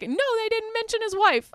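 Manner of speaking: high, exasperated mock outburst; mock angry. Offended writer answering Charlie before storming out, mock angry. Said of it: "storms out all angry".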